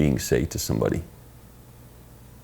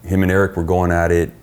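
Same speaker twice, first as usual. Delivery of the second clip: normal talking voice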